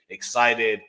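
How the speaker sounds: ordinary delivery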